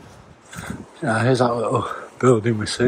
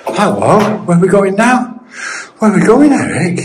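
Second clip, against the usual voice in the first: calling out; 7 semitones higher, 7 dB louder than usual. Real, not acted. Calling the dog on a hill.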